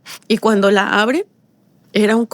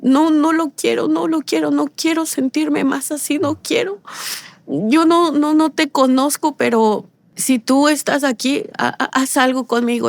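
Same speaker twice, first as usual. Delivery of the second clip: higher, pleading through remembered tears; retold crying prayer; 5 semitones higher than usual. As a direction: beg God as if crying again. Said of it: "Empiezo yo a llorar". (súplica llorosa, más aguda, recordada)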